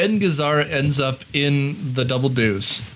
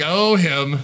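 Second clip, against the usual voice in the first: dismissive; 2 semitones lower than usual. Retold or imitated. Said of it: "Gazzara's like".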